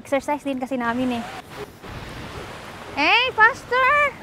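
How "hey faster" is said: high, slow, drawn-out call. Real, not acted. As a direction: stretch it out to hurry her along. Calling daughter to hurry.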